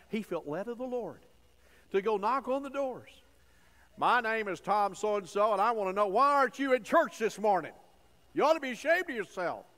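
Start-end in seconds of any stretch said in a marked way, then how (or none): 4.0-9.6 wag a finger and shame them; overbearing church visitor shaming a neighbor; scolding. Retold or imitated. Tom scolding neighbors at doors.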